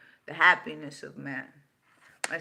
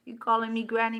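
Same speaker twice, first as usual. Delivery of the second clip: normal talking voice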